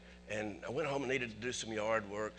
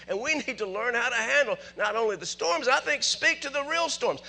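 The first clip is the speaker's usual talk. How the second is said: high, shouted revival preaching; roaring exhortation to the congregation; full-force preaching; 12 semitones higher, 15 dB louder, slower than usual. Real, not acted. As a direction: drive the lesson home, slow and very loud.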